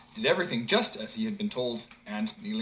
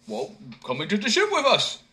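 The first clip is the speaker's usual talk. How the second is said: louder, higher call; simpleton inviting; 7 semitones higher, 6 dB louder than usual. A narrator's reading. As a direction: invite him aboard. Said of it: "answered the simpleton".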